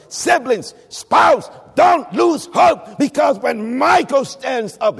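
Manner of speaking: loud, rising sermon cadence with punched phrases; preaching at full force. Real, not acted. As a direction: urge the congregation on in a ringing preacher's shout.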